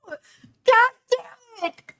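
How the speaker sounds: high, loud drawn-out wail; slow, strained howl of dismay; anguished cry. Real, not acted. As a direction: cry out in despair. Player despairs at character's wounding.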